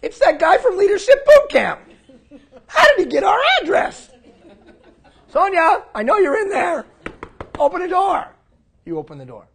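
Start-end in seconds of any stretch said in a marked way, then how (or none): 0.0-4.1 much higher, louder squealing panic with laughter; panicked mock squeal. Scene: Sonia panicking at the knock, high laughing squeal. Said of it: "I knock on your door". 5.3-8.3 much higher, louder call; calling through the door. Retold or imitated. Scene: speaker at Sonia's door, loud high calling. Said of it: "knocking on Sonia's door".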